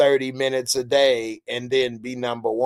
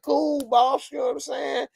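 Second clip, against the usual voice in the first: high mock soldier voice; 13 semitones higher than usual. Voicing a soldier talking to boss.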